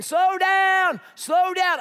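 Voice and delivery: put-on high, loud shout at runaway kids; screaming. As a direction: yell it out like a frantic dad. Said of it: "And I'm screaming".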